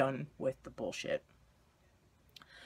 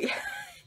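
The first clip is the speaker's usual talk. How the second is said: happy laugh. Real, not acted. Happy laugh after mom's acceptance.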